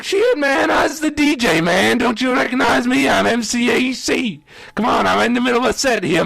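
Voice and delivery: high-pitched protest to a bouncer; acted-out exasperated appeal; indignant pleading. Pleading with bouncer to let him in.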